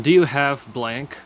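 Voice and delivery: slow, clear teacher delivery of the question; slow model sentence. Shown as a demonstration. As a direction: spell it out slowly for learners. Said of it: "teacher models the question".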